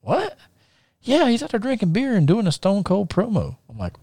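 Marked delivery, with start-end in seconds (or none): 0.0-0.4 startled. Retold or imitated. His startled reply to the news. 1.0-3.6 high-pitched cousin reporting news; high, urgent tattletale voice; excited cousin. Retold or imitated.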